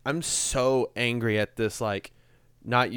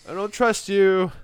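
high, loud, slow suspicious jab; distrustful; 9 semitones higher, 7 dB louder than usual. Retold or imitated. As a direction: drag it out with open distrust. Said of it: "I'm like".

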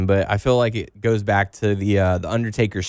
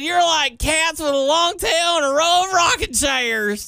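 mock Southern accent. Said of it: "not digging that Southern accent".

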